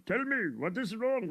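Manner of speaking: ordinary delivery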